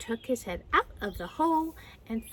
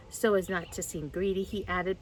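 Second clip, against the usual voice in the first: ordinary delivery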